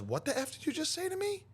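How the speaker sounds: high-pitched indignant challenge; retold affronted question; imitating offended girl. Offended girl confronts him.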